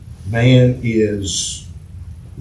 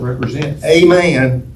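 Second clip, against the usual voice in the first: A said as a full "ay", raised and louder; emphasis on A; 4 semitones higher, 4 dB louder than usual. Shown as a demonstration. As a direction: lean hard on the article A. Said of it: "emphasis on a".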